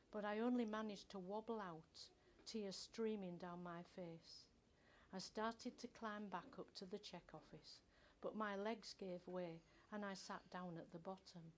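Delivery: ordinary delivery, nothing marked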